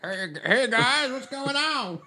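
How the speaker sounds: very high, slow, squeaky put-on voice; groundskeeper Jim voice. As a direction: squeak it out as a silly character. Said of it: "sounds an awful lot like Michelle".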